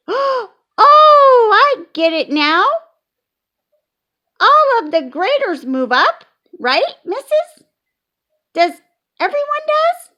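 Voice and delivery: put-on very high, loud little girl; excited realization. A narrator's reading. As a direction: burst out with the discovery.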